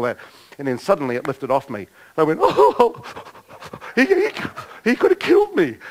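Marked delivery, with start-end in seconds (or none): 2.4-5.8 very high, stammering fright; mock panic. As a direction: squeal it as the danger suddenly sinks in. Re-enacts fright once faith lifted.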